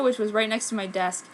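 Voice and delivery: usual speaking voice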